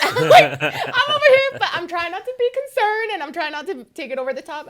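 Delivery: high, loud and laughing. Real, not acted. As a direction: rush it out while laughing. Laughing about her own concern.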